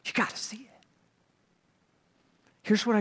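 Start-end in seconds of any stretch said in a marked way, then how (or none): 0.0-0.9 breathless whispered recommendation; soft awed hush; hushed excited whisper. Retold or imitated. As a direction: whisper it like a secret. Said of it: "you know what we say? We go".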